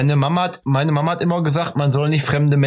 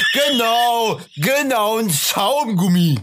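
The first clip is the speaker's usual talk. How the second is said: shouting; 8 semitones higher, much slower than usual. Acted. Shouted repetition.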